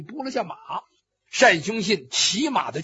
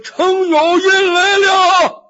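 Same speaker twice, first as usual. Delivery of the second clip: long, high, loud battlefield holler; distant shout; 9 semitones higher, 7 dB louder, much slower than usual. A narrator's reading. Luo Cheng arrives disguised as Cheng Yaoyin, drawn-out shout. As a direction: announce yourself from afar, stretching it out. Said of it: "罗成远远地喊了一声".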